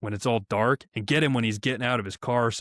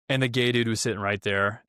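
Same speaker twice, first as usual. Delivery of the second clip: ordinary talk, nothing marked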